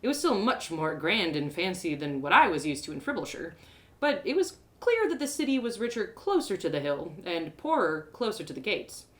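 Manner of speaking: ordinary delivery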